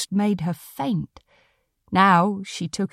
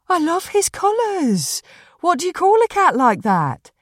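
high-pitched, gushing woman's voice; admiring dog walker; 10 semitones higher than usual. Acted. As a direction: gush over the cat, voice pitched high.

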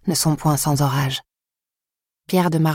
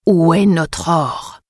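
slow, menacing growl, a notch lower; grinding deep sandworm; much slower than usual. Acted. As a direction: repeat it darker and slower. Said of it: "un ton plus bas".

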